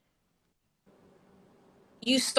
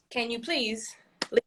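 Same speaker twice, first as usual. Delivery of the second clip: restrained repeat request; 5 dB quieter than usual. Acted. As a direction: repeat the request with strained patience. Parent repeats request to staring kids.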